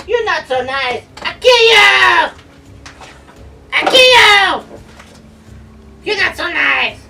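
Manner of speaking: very high, loud creature shriek; piercing goblin war cry, loud and high; angry goblin. Acted. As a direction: screech threats as an enraged little goblin. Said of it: "and he's like".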